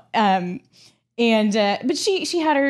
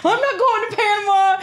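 high, loud mock grumble; grumpy stepdad; 11 semitones higher, 4 dB louder than usual. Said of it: "My stepdad's sitting over there like".

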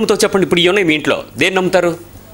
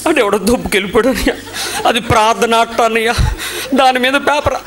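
mock whining boy, higher and laughing; whiny boy; 5 semitones higher than usual. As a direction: play the sulking boy half-laughing. Boy's whining quote retold. (ఏడుపుగొంతుతో పిల్లాడి అనుకరణ)

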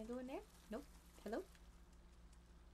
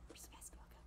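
whisper; 21 dB quieter, much faster than usual. Real, not acted. Whispering while exploring quietly.

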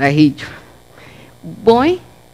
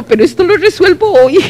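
high, louder burst of resolve; retold flustered resolve, pitched up; determined outburst; 9 semitones higher, 7 dB louder than usual. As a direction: declare it like nothing will stop you. Determined retelling of her decision.

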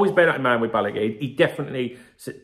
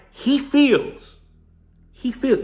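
he feels lifted much higher, leaning on feels; stress on feels; 11 semitones higher, 3 dB louder, much slower than usual. Shown as a demonstration. Pointing out the quote to viewers, stressing feels. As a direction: underline he feels as the key words. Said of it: "he's emphasizing that Alonso feels".

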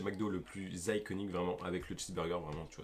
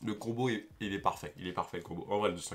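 speaking around food; talking while chewing; 4 dB louder than usual. Real, not acted. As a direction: mouth partly full. Seen 0: Bites burger at -5; cheeks bulge, jaw chewing while talking to 3.7.

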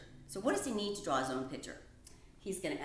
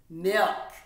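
loud reveal; 12 dB louder than usual. Acted. Mouse's big reveal.

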